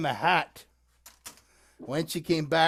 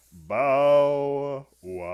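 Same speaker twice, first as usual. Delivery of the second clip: lower, slow drawn-out bow wow; dog bark; 3 semitones lower, 3 dB louder than usual. Acted. Dog answers the dame's curtsy, drawn-out bark. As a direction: stretch it out like a big dog's stately bark. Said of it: "and the dog said".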